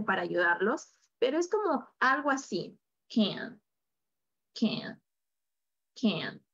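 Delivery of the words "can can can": teacher modelling "can" for students, relaxed and fading; effortless can. Shown as a demonstration. "can" with the a-e vowel, let it come from the throat. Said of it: "No hago ningún esfuerzo".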